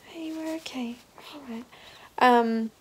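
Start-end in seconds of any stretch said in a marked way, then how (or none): 0.1-1.6 soothing whisper. Real, not acted. Mother whispers to calm her baby, hushed and tender. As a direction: breathe it softly to settle her.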